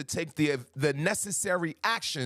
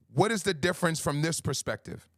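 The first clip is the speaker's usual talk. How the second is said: ordinary talk, nothing marked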